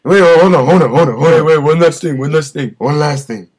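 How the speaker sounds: urgent pleading. Acted. Pleading before hang up.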